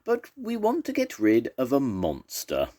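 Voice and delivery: lightly voiced warthog making a plea; polite warthog. A narrator's reading. Warthog addressing the beast.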